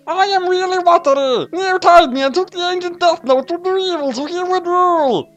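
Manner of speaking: put-on very high old English butler; high British butler voice. A narrator's reading. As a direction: squeak it like a trapped butler. Near reveals true identity.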